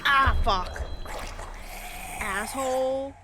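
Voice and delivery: frustrated cursing. Real, not acted. Caught by a monster.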